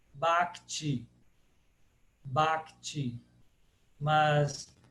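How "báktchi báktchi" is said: "bhakti" as "bák-tchi", open A; Brazilian pronunciation. Shown as a demonstration. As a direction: say "bhakti" with plain B and soft "tchi". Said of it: "no Brasil a gente fala". ("bhakti" abrasileirado, "báktchi", sem H)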